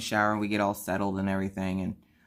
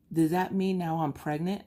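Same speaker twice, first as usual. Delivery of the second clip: put-on child voice; 7 semitones higher than usual. Kid voiced in story.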